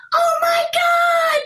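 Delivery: very high, drawn-out imitation of a screaming fan; mock fan scream. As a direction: squeal it slowly, far above your normal pitch. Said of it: "just, like, screaming at the top".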